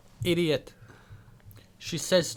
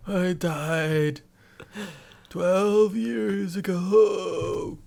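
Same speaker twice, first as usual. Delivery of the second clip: put-on very old man. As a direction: drawl it like a dying grandpa. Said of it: "fun to do an old man voice".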